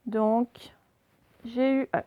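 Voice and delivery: usual speaking voice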